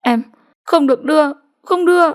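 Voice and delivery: put-on a beaten girl pleading in broken phrases; broken speech. A narrator's reading. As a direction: force each word out between breaths. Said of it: "Chị xỉu nói đứt quãng".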